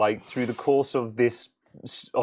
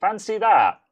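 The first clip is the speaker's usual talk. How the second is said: sarcastic surprise; 9 semitones higher, 5 dB louder than usual. Retold or imitated. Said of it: "being like".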